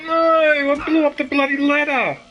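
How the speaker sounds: real anger at the game; frustrated shouting. Real, not acted. Player angry at game.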